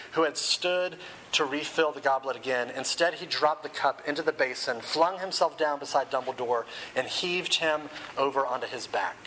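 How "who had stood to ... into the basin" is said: usual speaking voice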